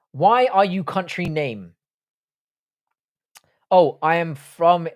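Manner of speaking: slow, drawn-out reading; slowly. Real, not acted. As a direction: stretch every word out, slowly. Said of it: "I started saying that slowly".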